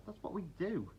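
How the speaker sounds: high, light little voices; dragonflies. A narrator's reading. Dragonflies explain.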